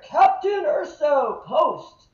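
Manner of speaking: slow, loud barked call of her name; sergeant's shout. Retold or imitated. First sergeant calling out a recruit, loud barked shout.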